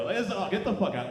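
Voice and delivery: ordinary delivery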